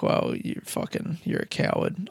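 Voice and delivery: hushed, scornful whisper. Acted. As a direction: whisper the insult with contempt. Quoting his scorn of the comic.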